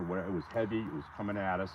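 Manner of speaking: normal talking voice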